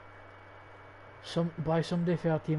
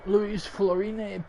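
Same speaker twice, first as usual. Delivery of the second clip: "Luis Florini" rolled out slow and higher in a hammed-up Italian accent; mock Italian accent; 4 semitones higher, 4 dB louder than usual. Gamer mocking the Italian-sounding player name, hammed-up Italian accent. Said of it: "He sounds Italian".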